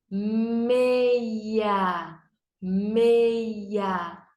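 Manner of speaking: Meia drawn out as "mmmei-ia"; stretched syllables. Shown as a demonstration. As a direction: stretch both parts of Meia slowly. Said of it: "Dois pedacinhos". (Meia esticado: "mmmei-ia")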